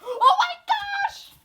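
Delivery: very high, loud delighted shriek; excited squealing. Real, not acted. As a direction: scream with joy at the sight. Unboxing an awaited package.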